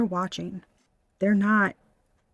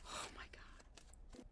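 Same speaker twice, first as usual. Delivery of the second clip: whisper; 30 dB quieter, much slower than usual. Real, not acted. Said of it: "repeats exclamation whispered".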